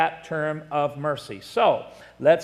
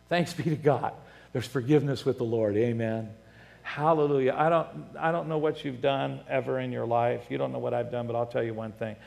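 soft, lowered, reverent preaching voice; hushed testimony; 5 semitones lower, 6 dB quieter than usual. Real, not acted. Preacher thanking God for his forgiveness, hushed and reverent. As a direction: drop low and quiet in gratitude.

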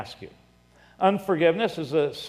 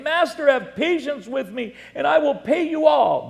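pleading; 10 semitones higher, 4 dB louder than usual. A narrator's reading. Servant begging his master for patience over a debt, pleading. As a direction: read as a high-pitched appeal. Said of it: "fell down before him".

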